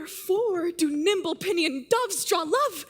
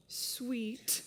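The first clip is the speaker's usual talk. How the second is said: soft, lower sweet; 7 semitones lower, 13 dB quieter than usual. Acted. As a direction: drop to a hushed, lower "Sweet.". Juliet repeats word, much softer.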